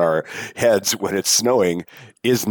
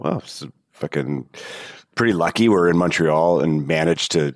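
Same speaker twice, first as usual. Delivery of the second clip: ordinary talk, nothing marked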